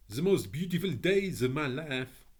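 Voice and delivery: put-on high, French-accented lady's welcome. A narrator's reading. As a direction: greet them in a lilting French accent. French lady speaks.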